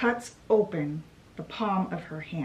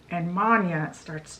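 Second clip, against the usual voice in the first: usual speaking voice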